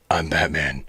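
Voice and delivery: very low, hushed Batman growl. Acted. Toy reviewer voicing what the Batman figure growls, low growl. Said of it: "it kind of growls it".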